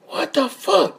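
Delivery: high, thin outraged squeak; strained, incredulous what-the-fuck; shocked outcry. Retold or imitated. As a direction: squeal it in disbelief at the heat. Shocked by the burning sun.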